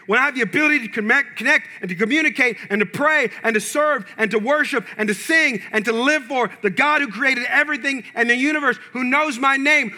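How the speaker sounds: preaching at full force. Acted. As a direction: build the praise to a peak. Preaching climax.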